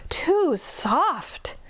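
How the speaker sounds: a strained high whisper, sinking in; breathless whisper. A narrator's reading. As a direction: gasp it out, half smothered. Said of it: "she said".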